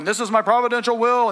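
lofty mock proclamation; overlording. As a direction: play a domineering God handing down a decree. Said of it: "He's not overlording you going".

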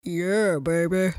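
low, silly put-on voice; weird voice. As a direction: drop it low and goofy. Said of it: "That's a weird voice".

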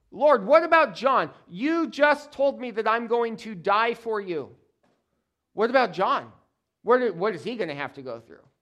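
loud, high-pitched complaint; indignant protest. Acted. Peter takes the bait and asks Jesus about John, indignant. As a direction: demand an answer, riled by a rival. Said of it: "Peter takes the bait".

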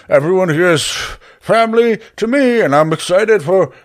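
loud, high, pointed proclamation; yelling back. Acted. Barry yelling back over his shoulder, loud and high. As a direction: call it out pointedly to someone behind you. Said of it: "as Barry kind of yells back over his shoulder".

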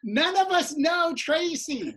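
loud, high, drawn-out complaint; exasperated. Retold or imitated. Performer tells heckler nobody knows Tracy, exasperated. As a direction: speak for the whole baffled room.

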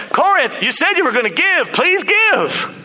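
pleading. Acted. Paul pleading with Corinth.

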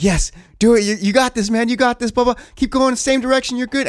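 high, loud excited cheering, urging him on; retold cheering. Dad cheering son through the final run, high and excited. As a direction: hype up your son as he runs the level. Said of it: "And he takes off and he's going and I'm like".

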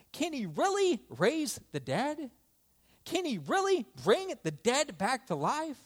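high, swooping disbelief; acted astonished self-questioning; incredulous wondering. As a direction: question it as if hardly daring to hope. Widow's incredulous question to herself.

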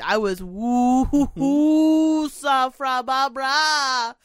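slow, drawn-out hooting breaths; mock panic breathing. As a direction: act out trying to calm your breathing. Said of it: "'Cause I need to breathe".

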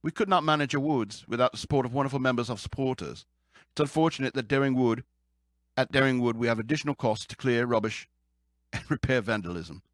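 badly faked English accent; mock-English accent for reading a quote; horrible English accent. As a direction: read it in a hammy amateur British accent. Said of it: "my horrible accent".

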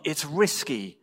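ordinary delivery

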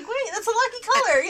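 high, loud, delighted realization; gleeful cheer. Retold or imitated. Team spots their lucky orange color, gleeful cheer. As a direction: squeal it and finish on a cheer.

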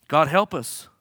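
ordinary talk

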